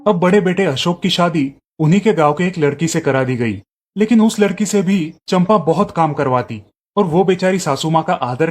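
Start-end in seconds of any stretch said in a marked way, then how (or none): none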